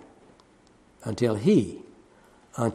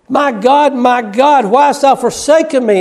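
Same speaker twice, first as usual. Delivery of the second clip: anguished cry; 9 semitones higher, 4 dB louder than usual. Acted. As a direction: scream it from the cross. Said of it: "He's screaming out".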